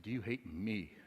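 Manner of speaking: a daughter quietly asks her father from the back seat; small ashamed voice. Retold or imitated. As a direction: ask it quietly, like a child afraid of the answer. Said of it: "That's the voice of shame".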